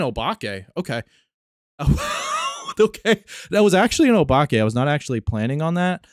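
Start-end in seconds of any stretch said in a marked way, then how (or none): 1.8-4.6 high, loud burst of surprise; surprised laugh. Real, not acted. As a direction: laugh it out, caught off guard. Ghost turns out to be Obake.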